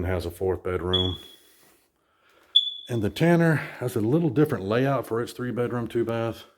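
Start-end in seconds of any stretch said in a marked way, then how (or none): none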